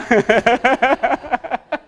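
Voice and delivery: real chuckling, rising in pitch; amused laugh. Real, not acted. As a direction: laugh at your own anecdote. Preacher laughs at shop-sign memory.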